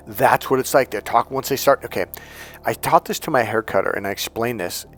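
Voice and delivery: usual speaking voice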